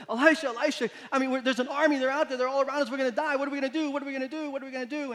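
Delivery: high, loud frantic pleading, fading toward the end; a terrified servant crying out for help; panicked servant. Retold or imitated. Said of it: "you can imagine the panic in his voice".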